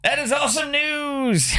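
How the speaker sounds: real excited cheer; excited shout. Real, not acted. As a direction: burst out with real delight. Hearing rates dropped.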